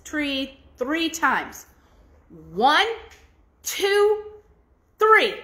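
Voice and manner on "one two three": each count louder and higher. Acted. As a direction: build the count up to a call. Fool strikes tree counting.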